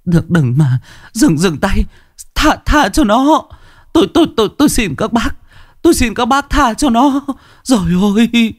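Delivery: high, trembling plea to spare her son; desperate pleading. Acted. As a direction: beg the crowd, voice breaking. Mother begging mob to spare son. (người mẹ van xin thảm thiết)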